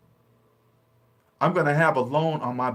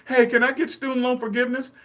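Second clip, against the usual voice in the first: pleading borrower; 7 semitones higher than usual. Retold or imitated. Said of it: "they're saying that to them".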